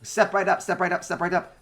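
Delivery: high loud barker call; carnival barker. Acted. Mechanical barkers calling out.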